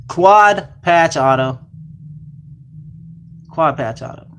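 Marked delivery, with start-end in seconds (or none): 0.1-1.6 drawn-out, louder card reveal; slow, louder hype call for the card; slow hype announce. Real, not acted. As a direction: stretch it out like a big pull.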